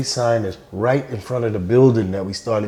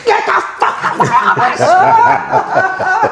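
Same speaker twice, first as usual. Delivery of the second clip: much higher, slow hammed-up yell through laughter; imitating a furious eviction; laughing mock roar; 3 dB louder, much slower than usual. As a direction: throw him out, drawn out. Joe yelling after billboard.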